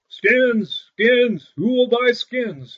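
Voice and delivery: high, loud peddler's call; street cry. A narrator's reading. Great Claus calling out to sell hides in town. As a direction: call your wares down the street. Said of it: "he shouted up and down the streets".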